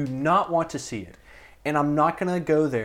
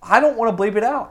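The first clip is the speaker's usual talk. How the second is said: snapping; 7 semitones higher, 6 dB louder than usual. Real, not acted. Heated argument.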